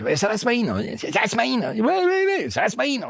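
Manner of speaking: high, anxious chorus of voices; hammed-up worried colleagues; fussing crowd. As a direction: mimic everyone fussing over him at once. Everyone crowding into dressing room.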